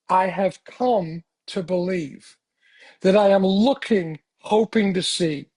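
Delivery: slow, measured statement of purpose; deliberate declaration. Acted. Answering why he is in AA, deliberate declaration. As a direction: state it word by word, slowly.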